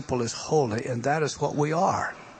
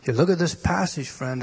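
normal talking voice

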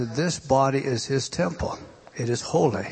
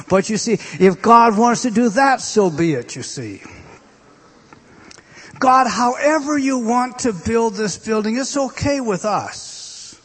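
higher and louder, slowing at the end; preaching cry; 9 semitones higher, 7 dB louder than usual. Real, not acted. Preacher crying out to God before the congregation. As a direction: lift it up in surrender.

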